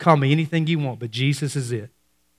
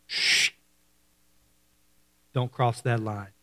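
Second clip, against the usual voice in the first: shush, then low and very soft; soft, low line-drawing after a long shush; hushed warning; 5 semitones lower, 9 dB quieter, slower than usual. Acted. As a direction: drop right down to a quiet, firm warning.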